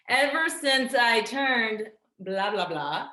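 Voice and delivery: pointedly drawn-out placeholder for a secret; emphatic evasion. Acted. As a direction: dodge it loudly and slowly, drawing out each word. Restarts, withholding her age.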